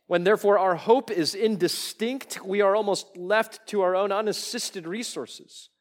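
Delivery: high, loud, ringing pulpit delivery; preaching at full force. Real, not acted. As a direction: lift the quote to a climax. Preacher rising on quoted line.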